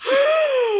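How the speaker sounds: loud, high laughing gasp. Real, not acted. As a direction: gasp in mock shock, then laugh. Mock shock, laughing gasp.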